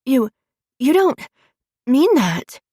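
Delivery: high, halting stammer; stuttering. A narrator's reading. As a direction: stammer it out in shock. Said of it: "she stutters".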